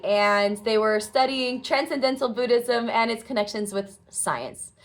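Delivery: ordinary delivery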